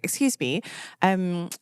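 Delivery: politely put-on British accent; very British, courteous request; extra British. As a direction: play up the British accent a little. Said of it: "I get that little bit more British".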